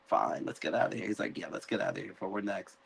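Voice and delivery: soft and fast, sneaking away; hushed hurried exit. Retold or imitated. Rhaenyra and Jacaerys sneaking off, soft and fast, fearing they're next. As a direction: hurry off quietly.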